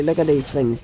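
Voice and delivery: normal talking voice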